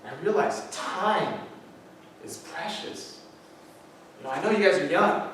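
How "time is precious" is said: time held long, precious nearly breathed; stress on precious. Acted. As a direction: land the pun time is precious softly. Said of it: "That last person's name was Precious".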